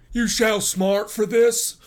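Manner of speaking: high, loud, slow threat; enraged cry. A narrator's reading. Humiliated suitor threatening the farmer, enraged. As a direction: threaten him at full voice.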